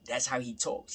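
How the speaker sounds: ordinary talk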